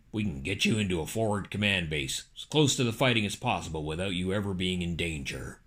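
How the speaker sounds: low, quiet calculating politician; Dick Cheney. Acted. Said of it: "Cheney said".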